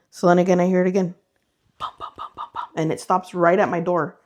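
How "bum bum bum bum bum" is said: whispered footstep thuds. Retold or imitated. Imitating footsteps in the kitchen.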